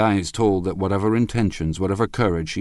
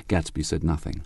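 plain reading voice

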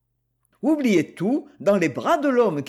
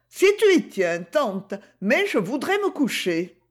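put-on higher, girlish voice of a stubborn young girl; lightly voiced young Gigi, protesting; 5 semitones higher than usual. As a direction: play the girl, defiant and earnest. Gigi answers aunt.

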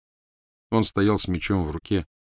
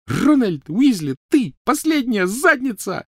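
high, loud, each word landed like a blow; slow, hammered-out insult; furious yelling; 6 dB louder, slower than usual. Acted. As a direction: yell it word by punched word. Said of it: "Каждое слово сопровождалось ударом".

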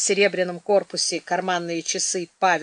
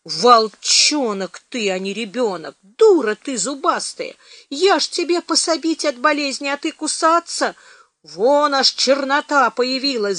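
mild pained wailing, pitch raised; lamenting; 5 semitones higher, 4 dB louder than usual. A narrator's reading. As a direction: scold the child in an aggrieved lament. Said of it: "причитая".

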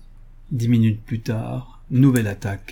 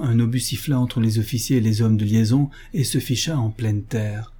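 ordinary delivery, nothing marked

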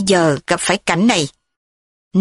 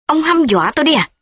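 alarmed; 7 semitones higher than usual. A narrator's reading. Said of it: "Thúy hốt hoảng".

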